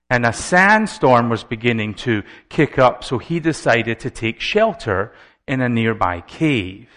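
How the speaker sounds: ordinary delivery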